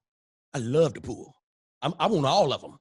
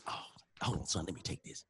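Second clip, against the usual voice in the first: much softer, almost whispered "hold on"; hushed aside; 9 semitones lower, 14 dB quieter than usual. Retold or imitated. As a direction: drop to a hush to take the call. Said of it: "He get a phone call".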